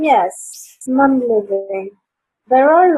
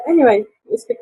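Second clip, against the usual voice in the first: ordinary delivery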